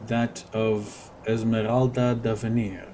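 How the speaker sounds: ordinary talk, nothing marked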